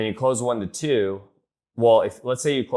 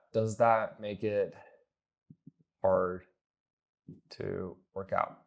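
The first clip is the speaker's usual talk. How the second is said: very slow, long pauses around "hard"; exaggerated halting delivery; 5 dB quieter, much slower than usual. Shown as a demonstration. Coach shows how not to ask prospects, halting and drawn out. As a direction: break it up: "Does that make it... hard... to work out", dropping softer. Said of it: "I don't say it like that".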